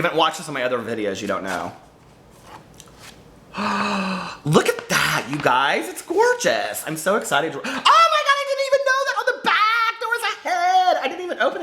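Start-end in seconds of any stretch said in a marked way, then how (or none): 3.5-4.4 gasp. Real, not acted. Reveals the hidden book cover. 7.6-11.6 much higher, laughing with delight; delighted squeal. Real, not acted. Discovers head on book back.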